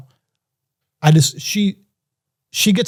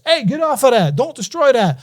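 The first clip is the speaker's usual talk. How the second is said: put-on higher, sharp warning to the kids; scolding kids; 7 semitones higher than usual. Telling the boss's kids to get off things. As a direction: call out to stop the kids touching things.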